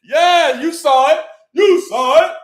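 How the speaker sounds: excited shout. Real, not acted. Hyping viewers.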